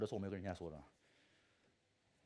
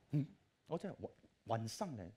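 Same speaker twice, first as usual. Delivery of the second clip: ordinary delivery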